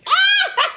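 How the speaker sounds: very high mock scream with laughter; imitated shriek. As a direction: mimic his shriek, half laughing. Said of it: "He was like, he was like".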